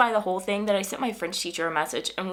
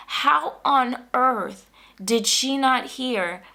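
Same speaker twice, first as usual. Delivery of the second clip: ordinary talk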